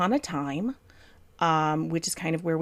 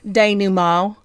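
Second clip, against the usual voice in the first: drawled Southern denouement, slow and loud; Southern accent; 3 semitones higher, 10 dB louder, slower than usual. Pretend. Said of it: "fun to say in a Southern accent".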